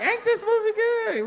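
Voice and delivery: very high, sweet question; high woman's voice. Acted. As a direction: ask like the date, high. Woman at the movie again.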